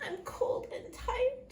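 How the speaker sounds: high, slow, plaintive whine; crying voice. A narrator's reading. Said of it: "she cries".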